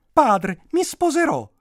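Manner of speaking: high, loud princess voice; hammed-up princess declaration; announcing princess. A narrator's reading. Princess announces marriage to father.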